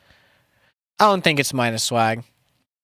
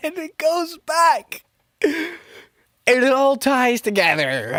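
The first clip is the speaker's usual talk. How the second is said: high voice; 11 semitones higher, slower than usual. Acted. Musing aloud, high sung-like.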